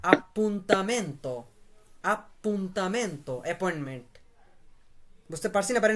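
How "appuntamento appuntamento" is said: "appuntamento" slowly, syllables joined but distinct; measured model of "appuntamento", ap-pun-ta-men-to; slow syllable reading. Shown as a demonstration. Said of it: "এটা syllable আকারে পড়বো আমরা".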